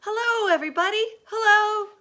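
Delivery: very high, loud joyful hello; calling out. A narrator's reading. James calls out to the crowd below, very high and loud. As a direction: shout down from high above, delighted. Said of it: "laughing and waving and calling out".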